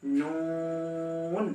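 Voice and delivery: নুন nasal hum held long; Arabic letter noon. Shown as a demonstration. Teacher reciting নুন slowly. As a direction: draw out নুন in a sung tone. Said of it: "এরপর".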